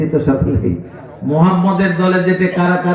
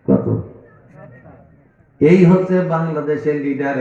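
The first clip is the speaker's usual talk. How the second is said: usual speaking voice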